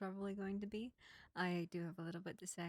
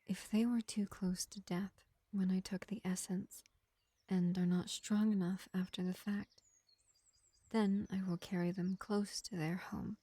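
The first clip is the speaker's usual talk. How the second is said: lightly voiced vampire healer, projected above the chatty voice; gentle vampire medic; 7 dB louder than usual. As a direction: play a measured vampire reassuring a wounded elf, with long pauses. Plays vampire medic to an elf.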